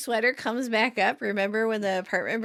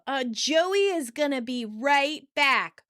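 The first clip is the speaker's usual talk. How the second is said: slow, over-articulated, higher-pitched announcement; loud and slow; 4 semitones higher, slower than usual. Retold or imitated. Chandler explaining to an old woman, loud and slow. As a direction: spell it out slowly word by word. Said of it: "says loudly and slow".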